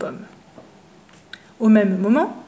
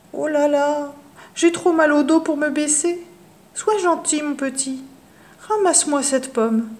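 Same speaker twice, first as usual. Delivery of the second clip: high, groaning crone voice; put-on pitiful granny; whiny old witch; 4 semitones higher than usual. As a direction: fake the aches to trick the boy. Witch pretends back pain.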